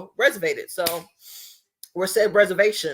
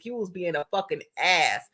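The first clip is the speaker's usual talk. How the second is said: ordinary talk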